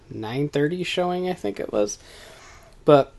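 ordinary talk, nothing marked

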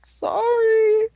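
tiny, very high squeaky sorry; mock apology. Apologizing to co-host for forgetting, mock high whimper.

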